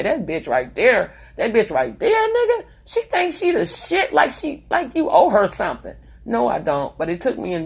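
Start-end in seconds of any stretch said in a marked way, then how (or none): 0.0-6.0 mocking ex. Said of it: "they say".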